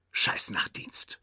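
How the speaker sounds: a slow muttered whisper under the breath; whispered curse. Retold or imitated. Aside about his night shift, a slow whispered curse. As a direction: grumble it to yourself, barely voiced.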